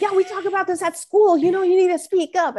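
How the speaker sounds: high, earnest kid lecturing, imitated; know-it-all teen son. Son schooling mom on speaking up, imitated earnestness. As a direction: pitch it way up as an eager schoolboy.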